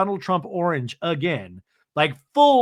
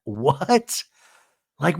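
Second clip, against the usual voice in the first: ordinary talk, nothing marked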